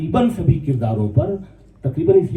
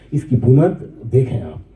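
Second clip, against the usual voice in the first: ordinary delivery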